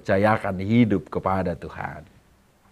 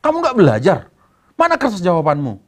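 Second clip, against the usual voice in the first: angry mother; 10 semitones higher than usual. Acted.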